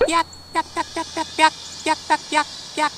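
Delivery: high little peeps; chick cheeping. Retold or imitated. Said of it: "they say".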